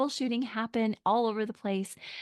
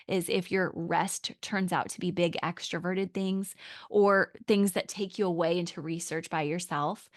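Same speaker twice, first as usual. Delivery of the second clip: usual speaking voice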